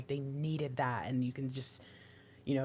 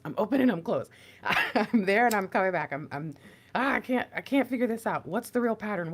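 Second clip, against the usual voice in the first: higher, louder, flustered and laughing; mock frantic flailing; 5 semitones higher, 6 dB louder than usual. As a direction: play someone lost mid-dance. Acting frantic confused dancer.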